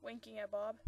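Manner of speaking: ordinary delivery, nothing marked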